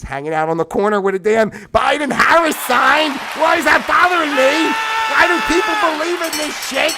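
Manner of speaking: high, loud exasperated outburst; exasperated rant. Real, not acted. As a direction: throw up your hands and yell the questions. Ranting about people's beliefs.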